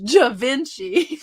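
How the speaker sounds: Southern Ja Vinci, slow "juh-VIN-chee"; Southern pronunciation. Shown as a demonstration. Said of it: "Classic Southern".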